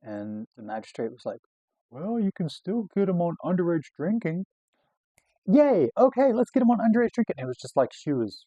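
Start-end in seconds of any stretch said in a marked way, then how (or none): 1.9-4.4 raised-pitch mock magistrate; imitated magistrate. Magistrate to disappointed cop, imitated helpful suggestion. 5.5-7.4 high, loud mock cheer; excited. Cop to magistrate on finding a charge, excited. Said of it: "you know, excited".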